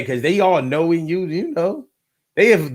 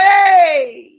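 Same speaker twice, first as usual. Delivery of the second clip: much higher, louder mock-friendly hey; fake cheerful hey; 11 semitones higher, 6 dB louder than usual. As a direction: overplay a polite greeting to a stranger. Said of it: "I was like".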